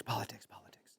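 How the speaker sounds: fading into a whisper; trailing whisper of a fed-up child. Acted. Mocking nagging parents' politics talk.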